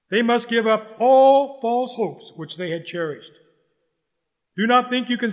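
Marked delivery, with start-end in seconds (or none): 0.1-3.3 louder, "all" hit hard in the repeat; forceful repeat. Shown as a demonstration. Preacher warning Pharisees and congregation, loud insistence. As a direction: push it out like a warning from the pulpit.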